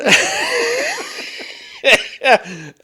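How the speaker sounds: real high laugh; laughter. Real, not acted. Storyteller still amused by the tale, high laughter. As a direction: keep cracking up, high and loud.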